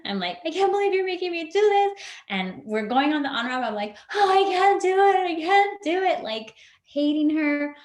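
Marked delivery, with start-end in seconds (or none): 0.4-2.0 much higher, louder retold outburst; lashing out. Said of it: "lashed out at her in the car". 4.1-6.2 much higher, louder retold panic; panicked. Panicking on the freeway on-ramp.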